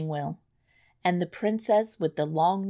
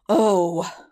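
loud exasperated oh; cry out; 8 dB louder than usual. A narrator's reading. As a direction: cry out in disgust. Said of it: "cried the prince".

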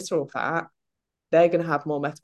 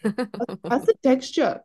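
put-on slow, higher South African accent; 7 semitones higher, much slower than usual. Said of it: "South African accent".

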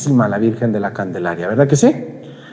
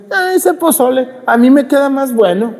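hammed-up braggart, much higher pitch; bragging cook; 12 semitones higher, 3 dB louder than usual. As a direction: brag about the pozole in a high mock voice. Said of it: "habladoras que dicen".

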